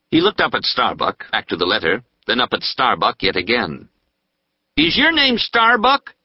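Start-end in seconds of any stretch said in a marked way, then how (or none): 4.8-6.1 high, slow, loud accusing question. Acted. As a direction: call him out in front of the crowd. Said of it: "he asked loudly".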